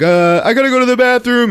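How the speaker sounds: high, loud, scared excuse; hammed-up frightened schoolboy; panicked blurt. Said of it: "It scares him, and he's like".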